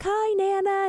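put-on high, chirpy child's greeting; little boy. Grandson walking in to greet Nana, childlike. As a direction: pitch it way up like a young kid. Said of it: "Tyler was little".

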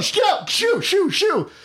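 high yelled shoo; shooing shout. Acted. Shooing imaginary pterodactyl away.

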